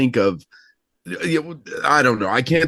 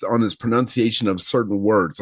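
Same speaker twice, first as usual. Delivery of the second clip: normal talking voice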